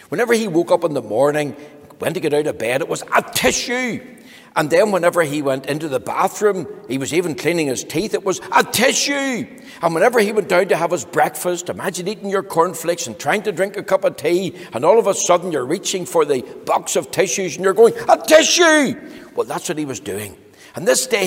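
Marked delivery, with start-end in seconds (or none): 3.1-4.0 sneeze. Acted. Mister Sneeze sneezes getting out of bed. 8.5-9.4 high loud "A tissue" sneeze. Acted. Mister Sneeze sneezes getting out of bed. Sneezing while cleaning teeth. 18.1-19.0 high loud "A tissue" sneeze; big sneeze. Acted. Mister Sneeze sneezes over breakfast cornflakes.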